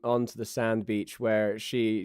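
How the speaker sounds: normal talking voice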